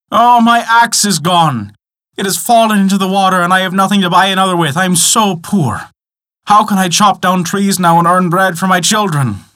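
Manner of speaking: high, plaintive wail of woe; acted lament. A narrator's reading. Poor man laments his lost axe, high plaintive voice.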